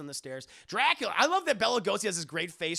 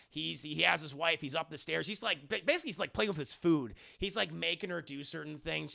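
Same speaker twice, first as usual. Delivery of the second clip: ordinary talk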